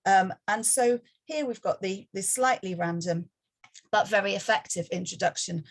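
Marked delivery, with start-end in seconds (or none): none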